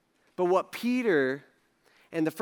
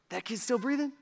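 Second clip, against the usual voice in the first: worried parent; 4 semitones higher, 7 dB quieter than usual. Acted. Anxious parent's night-time thought.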